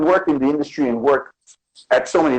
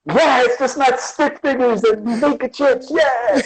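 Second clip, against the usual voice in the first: laughing; 10 semitones higher, slower than usual. Retold or imitated. Joking reply to mom.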